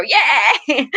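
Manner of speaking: high cheer, laughing through it; imitating granddaughter's yay. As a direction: mimic a little girl's happy yay. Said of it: "I just hear my granddaughter go".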